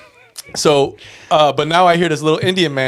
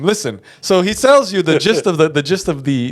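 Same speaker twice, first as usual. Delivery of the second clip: usual speaking voice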